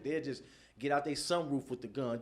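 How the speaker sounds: usual speaking voice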